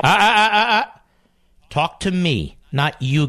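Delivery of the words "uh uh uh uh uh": sharp "uh, uh" to cut him off; loud interruption. Real, not acted. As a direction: stop the caller mid-sentence. Host cuts off the caller.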